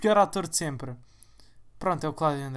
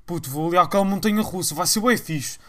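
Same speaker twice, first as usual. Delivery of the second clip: mock hype; 8 dB louder than usual. Mimics thrill-seeking friends.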